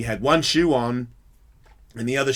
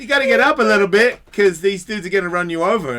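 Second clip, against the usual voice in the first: loud, high urging; urgent pleading; 9 semitones higher, 8 dB louder than usual. Retold or imitated. Passer-by urges drunk off the road, urgent pleading. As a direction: plead loudly with a drunk to move.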